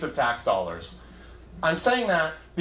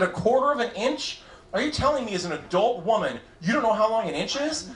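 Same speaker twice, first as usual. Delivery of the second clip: incredulous; 7 semitones higher than usual. Retold or imitated. Disbelieving son questions mom.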